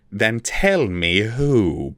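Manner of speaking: very slow, hard demand; barking. A narrator's reading. Vilnix barks at the captive elf, a very slow hard demand. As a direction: bark the command. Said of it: "barked Vilnix".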